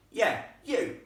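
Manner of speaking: loud, high blunt retort; bossy boy's loud reply; rough boy ghost. Acted. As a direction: throw it back bluntly as a bossy lad. Ghost orders the girl about.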